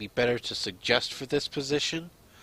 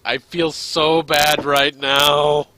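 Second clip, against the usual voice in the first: loud, slow, put-on Diana voice; Diana's voice; 3 semitones higher, 10 dB louder, much slower than usual. Diana leaving the house, loud, slow and miserable. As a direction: drag it out loudly, guilty and miserable. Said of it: "Diana's like".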